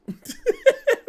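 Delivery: a sudden burst of loud, high laughter. Real, not acted. Streamer laughing at his own joke to chat, loud burst.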